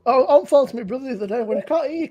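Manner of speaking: normal talking voice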